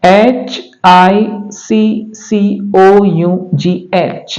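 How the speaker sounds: slow letter-by-letter spelling; एच आई सी सी ओ यू जी एच spelled out slowly. Shown as a demonstration. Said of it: "एक word है जैसे".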